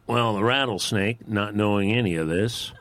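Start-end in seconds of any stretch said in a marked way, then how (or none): none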